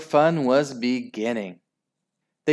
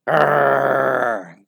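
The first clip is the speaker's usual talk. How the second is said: loud, rough dog growl; 2 semitones higher, 6 dB louder than usual. Acted. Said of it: "growled the dogs".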